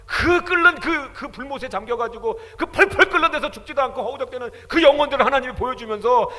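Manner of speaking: loud, high, impassioned sermon; fiery preaching. Acted. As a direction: preach it with full fervor. Preaching at full force.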